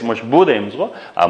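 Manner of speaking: ordinary delivery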